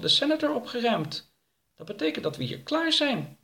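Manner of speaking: very high-pitched character voice; high little character chatting; chatty story character. Acted. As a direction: keep it high throughout, at normal volume. Voices Bubbel in dialogue.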